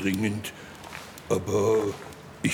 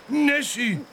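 much higher, urgent call. Acted. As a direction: call out the name. Boy calling for the vanished monster.

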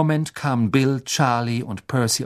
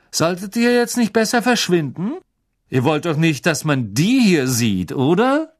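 put-on sneering rich boy; 6 semitones higher, 4 dB louder than usual. A narrator's reading. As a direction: look down on them and enjoy it. Malfoy taunting.

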